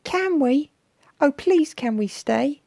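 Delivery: very high, loud little pleading voice; squeaky young monster's plea; Raymond. A narrator's reading. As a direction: beg eagerly like an excited youngster. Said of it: "Raymond asked".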